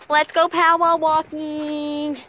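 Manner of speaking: slow holler, last word stretched; play-acted rallying call; drawn-out announcement. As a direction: call it out slowly. Announcing the power walk, drawn out.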